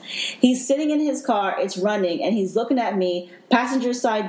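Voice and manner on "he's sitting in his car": slow, high, fighting off crying; holding back tears. Real, not acted. Retelling her rescue to the host, slow and near tears. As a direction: get the words out through emotion. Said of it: "really trying not to get emotional".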